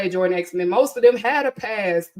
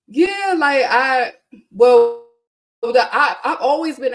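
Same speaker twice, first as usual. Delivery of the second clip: excited burst; 5 semitones higher, 7 dB louder than usual. Real, not acted. Excited outburst about X-Men.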